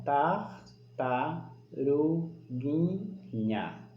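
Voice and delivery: "tartaruguinha" split into separate slow syllables with pauses. Shown as a demonstration. Teacher showing separated syllables, one at a time. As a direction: say "tartaruguinha" as tar, ta, ru, gui, nha. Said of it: "Eu separei a sílaba embaixo".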